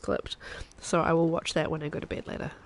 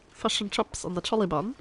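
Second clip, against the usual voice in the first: New Zealand vowels, "fush and chups"; exaggerated New Zealand accent; 2 semitones higher than usual. Shown as a demonstration. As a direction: say it in a hammed-up Kiwi accent. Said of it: "That is a very stereotypical Australian accent".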